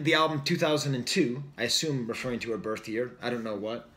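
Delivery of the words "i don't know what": normal talking voice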